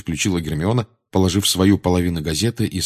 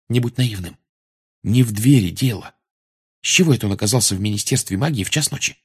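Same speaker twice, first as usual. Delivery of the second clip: ordinary delivery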